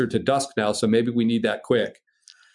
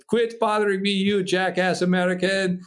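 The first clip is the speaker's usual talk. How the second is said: higher-pitched mock telling-off; hammed-up irritated brush-off; exasperated foreigner; 7 semitones higher than usual. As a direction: imitate an annoyed man dismissing a prankster. Frenchman brushing off his call.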